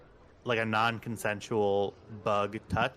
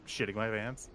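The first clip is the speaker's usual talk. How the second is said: usual speaking voice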